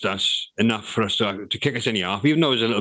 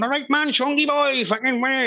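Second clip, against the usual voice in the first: much higher, bold swaggering Welsh rapper voice. Retold or imitated. Telling the interviewer how [name] found his own voice, a swaggering Welsh rapper voice. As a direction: show him finally rapping as himself. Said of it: "he was fucking going".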